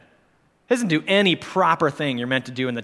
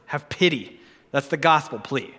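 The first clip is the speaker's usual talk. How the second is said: ordinary talk, nothing marked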